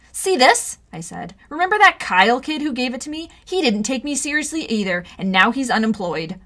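fierce warning; menacing. Acted. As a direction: warn them off. Showing splinted hand threateningly.

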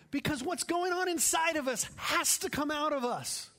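high, loud, impassioned preaching; preaching at full force. Acted. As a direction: exhort the congregation with fire.

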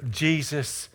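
low, halting, hushed scripture delivery; solemn preaching. Real, not acted. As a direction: drop low and soft, phrase by phrase.

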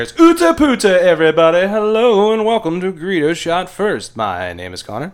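loud, upbeat announcer welcome; podcast host intro. Acted. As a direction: open the show like a host.

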